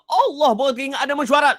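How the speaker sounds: high, fast, startled realization; sudden panic. Retold or imitated. Father suddenly remembers the meeting, startled and hurried. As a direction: blurt it out in a rush of alarm.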